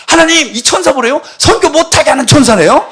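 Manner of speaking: loud, high indignant complaint to God; hammed-up tantrum against the angel; mock outraged protest. Acts out fight with angel.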